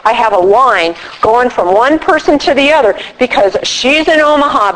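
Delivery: normal talking voice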